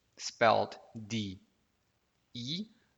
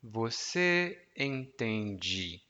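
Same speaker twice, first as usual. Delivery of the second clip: slowly, each syllable clear; slow model phrase; much slower than usual. Shown as a demonstration. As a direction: spell it out slowly for the learner. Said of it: "it would be".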